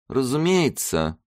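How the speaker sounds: high, slow weary reply; tired. A narrator's reading. Zaika lets Karolina have a guess, tired. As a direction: draw it out with strained patience. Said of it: "сказал он усталым голосом".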